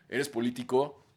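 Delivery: normal talking voice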